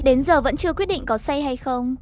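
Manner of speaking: ordinary delivery, nothing marked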